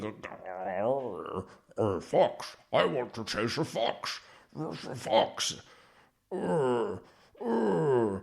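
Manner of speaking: a hammed-up dog speaking through a psychic; dopey dog voice. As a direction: grunt out words like a dumb dog.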